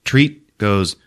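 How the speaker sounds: ordinary talk, nothing marked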